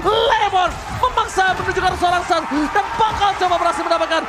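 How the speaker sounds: loud, high hype play-by-play; fighting game announcer. Acted. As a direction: call the teamfight at full hype. Hype teamfight call.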